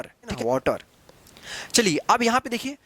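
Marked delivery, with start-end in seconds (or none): 0.4-0.8 वाटर said low as "waw-tar"; rounded aw in वाटर; correct "aw" vowel. Shown as a demonstration. Teacher confirming the correct pronunciation, with aw. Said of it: "उसका सही उच्चारण है".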